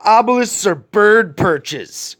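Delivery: high, sneering imitation of his mother; mocking high mother voice. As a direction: mimic her dismissive lie with scorn. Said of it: "Would tell".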